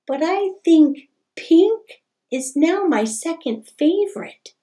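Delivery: bright, lively storybook reading; reading to children. A narrator's reading. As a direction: read it like story time for kids. Reads picture book aloud.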